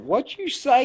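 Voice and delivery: high, bristling "what you say?"; retold challenge. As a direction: fire it back like a dare. Confronting the insult at bar.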